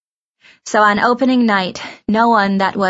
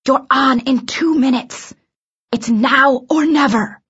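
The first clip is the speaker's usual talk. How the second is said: high, slow, urgent stage whisper-shout; hush shouting; 6 semitones higher than usual. A narrator's reading. Director warning the understudy from the door, hush shouting. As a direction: urge them on in a strained hush. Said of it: "hush shouting from the door".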